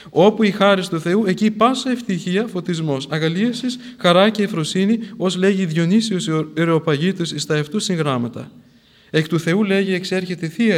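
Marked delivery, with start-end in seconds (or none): 0.1-8.5 louder, raised praise; fervent sermon praise; preaching. Acted. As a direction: lift the list of joys up. Extols the grace of God.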